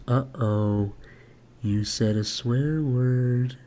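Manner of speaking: put-on slow, low, creepy childlike voice; mild creepy child voice, very slow; creepy little kid. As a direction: sound like an eerie little kid, drawn out slowly. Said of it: "However he says it, it's gonna be very creepy, like a little kid".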